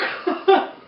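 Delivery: a short hearty ho-ho chuckle; jolly chuckle. Acted. Uncle Mitch acting Santa's belly laugh for kids, jolly chuckle.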